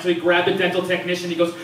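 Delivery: normal talking voice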